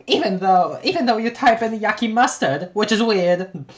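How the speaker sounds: high, loud, teasing Terezi; gleeful, loud teasing. Acted. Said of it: "I love doing stupid voices".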